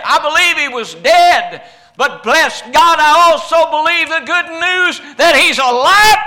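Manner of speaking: loud, high, ringing sermon climax; full-force preaching. Real, not acted. Preacher to congregation, shouting at full force. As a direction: preach it out at the top of the voice.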